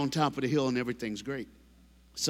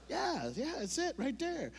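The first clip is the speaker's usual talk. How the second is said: soft high aside; 11 semitones higher, 9 dB quieter than usual. Real, not acted. Reacting to audience repeat.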